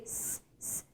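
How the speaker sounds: S, S said as a long voiceless hiss; hissed S sound. Shown as a demonstration. Teacher showing the S sound to students. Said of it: "som de S".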